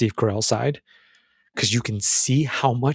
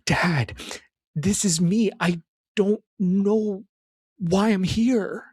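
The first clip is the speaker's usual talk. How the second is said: lost and pleading; 7 semitones higher, much slower than usual. Retold or imitated. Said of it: "Nick was saying".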